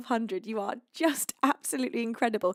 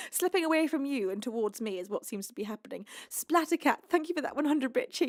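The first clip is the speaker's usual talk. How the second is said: ordinary talk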